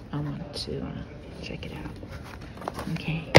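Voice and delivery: soft library whisper. Real, not acted. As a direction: hush it like in a library. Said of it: "kind of whispering 'cause I am in the library".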